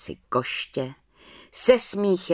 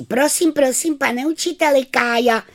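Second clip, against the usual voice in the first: put-on much higher, louder whiny child; tattling schoolgirl; 12 semitones higher, 6 dB louder than usual. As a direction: tell on him eagerly.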